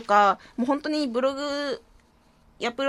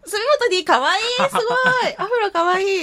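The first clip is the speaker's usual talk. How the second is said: put-on higher, louder girly gushing; 8 semitones higher, 5 dB louder than usual. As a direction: squeal like a giddy schoolgirl. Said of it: "何を見ても可愛いって言っとけば".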